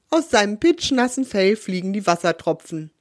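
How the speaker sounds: ordinary delivery, nothing marked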